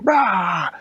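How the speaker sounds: loud, high sudden shout; scary jump scare shout; jump scare roar. Acted. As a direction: bark it out to startle. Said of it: "the siege jump scared him".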